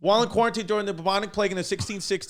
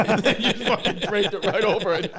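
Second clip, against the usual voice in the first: laughing; 4 semitones higher than usual. Real, not acted. Laughing at the Trump flag.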